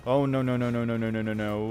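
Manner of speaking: loud panicked no no no, fading down; panic, then calm down bit by bit. Real, not acted. Panicking as enemy closes in.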